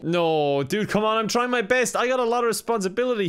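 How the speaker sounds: high, put-upon complaint; whining protest. Real, not acted. Overloaded censor to the presenter, whining protest. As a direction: plead that you're doing all you can. Protests being blamed for failed bleep.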